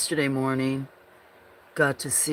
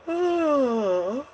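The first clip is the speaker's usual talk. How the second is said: yawning sigh; 10 semitones higher than usual. Real, not acted. Another yawn.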